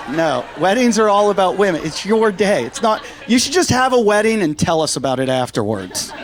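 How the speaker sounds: ordinary talk, nothing marked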